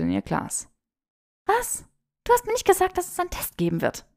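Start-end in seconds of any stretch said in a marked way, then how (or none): 1.5-4.1 put-on high, fast protesting young woman; a flustered girl caught out; flustered Hermine. A narrator's reading. Hermine, caught off guard by a test, high flustered protest.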